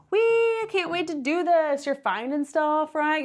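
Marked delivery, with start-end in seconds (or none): 0.1-1.8 excited squeal. Retold or imitated. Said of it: "you're like".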